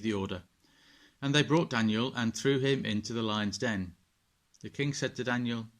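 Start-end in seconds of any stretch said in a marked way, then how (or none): none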